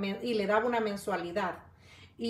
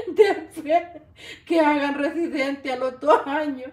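louder, higher words through laughter; laughing read; 3 semitones higher, 6 dB louder than usual. Real, not acted. Streamer laughing as she reads a viewer's comment. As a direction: read it out while still laughing.

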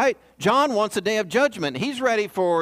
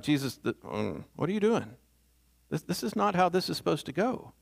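soft, hesitant objection, as John; confused aside; 5 dB quieter than usual. Acted. John baffled by what Jesus is doing, hushed protest. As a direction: falter into a quiet, baffled protest.

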